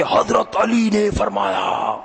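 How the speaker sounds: high, slow mock bellow; imitating a yelling preacher. Imitating a cleric thundering in his sermon, drawn-out mock yell. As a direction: stretch it out like a preacher crying out. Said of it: "बहुत जोर से कहते".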